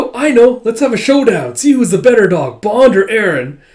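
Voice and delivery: put-on high, loud, eager kid voice; excited child. Child proposing a dog showdown, excited. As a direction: announce it like a kid with a great idea.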